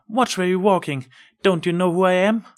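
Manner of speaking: high, loud, haughty snap at a stranger; irritated exclaim. Acted. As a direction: put the old mare in her place, offended. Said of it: "exclaimed in irritation".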